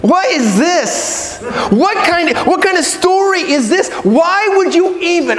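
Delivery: high, exasperated disbelief; incredulous outrage. Acted. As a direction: protest as if the plan is absurd. Acted disbelief at the log.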